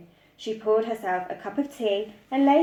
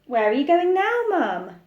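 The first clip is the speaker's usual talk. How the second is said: put-on much higher, louder little girl; high, louder questioning girl; child's voice; 8 semitones higher, 6 dB louder than usual. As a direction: call after mum like a child. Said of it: "asked Laura".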